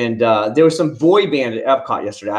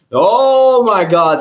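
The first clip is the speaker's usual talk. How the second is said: much higher, louder, stretched oh my God; exaggerated exclamation; 9 semitones higher, 7 dB louder, much slower than usual. Real, not acted. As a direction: howl it out in mock shock. Exclaims about the drama queens.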